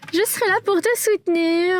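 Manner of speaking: high-pitched mock-supportive voice; mocking imitation. Woman mimicking her boyfriend's promise to support her, high mocking voice.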